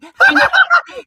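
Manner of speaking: high, loud hammed-up cackle; a shrill mock laugh aimed at a chat troll; mocking laugh imitation. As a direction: parody the opponent's chat laugh. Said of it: "with his little laughs".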